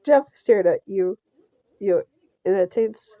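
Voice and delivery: ordinary talk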